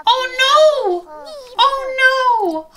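high, loud gasping cry; gasp, then exclaim high and loud; shocked dismay. Real, not acted. Watches child get pushed away.

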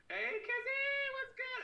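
very high, loud squealed hello; excited greeting. Real, not acted. Streamer greeting Kizzy joining, high squealed hello. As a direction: squeal it out to a friend.

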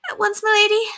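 very high, squeaky servant voice; squeaky housemaid. A narrator's reading. Housemaid answering her lady's summons, high squeak. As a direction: pipe up, squeaky as a fiddle. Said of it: "with her high voice squeaky as a fiddle".

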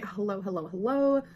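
ordinary delivery

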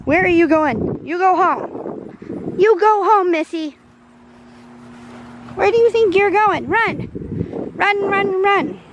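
high, drawn-out call to a pet; sing-song calling after a pet; playful high calling. Real, not acted.

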